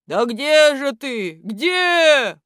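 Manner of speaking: loud, high, drawn-out calls; взывающий зов. A narrator's reading. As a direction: keep calling out anxiously. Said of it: "продолжали взывать". (громко, протяжно взывать)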